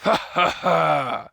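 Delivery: slow, loud, mean "Ha, ha, ha!" from a big pirate; villain's booming mocking laugh. A narrator's reading. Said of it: "laughed a mean, ugly laugh".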